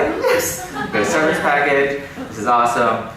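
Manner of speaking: high, loud burst of joy; excited whoop. Retold or imitated. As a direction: cheer it out, thrilled. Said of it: "And I was like".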